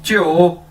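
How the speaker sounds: च्यो as one glide like प्यो; Chinese jiu. Shown as a demonstration. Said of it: "उसी तर्ज के अंदर बोलना है च्यो".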